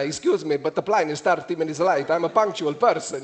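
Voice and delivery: put-on Italian accent. Passenger complaining to the airline about a late plane, Italian accent. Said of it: "I thought I'd try it in an Italian accent".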